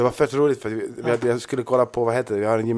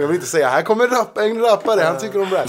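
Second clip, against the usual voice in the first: laughing; 8 semitones higher, 4 dB louder than usual. Retold or imitated. Mock TV presenter intro, laughing.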